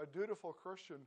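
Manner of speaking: ordinary talk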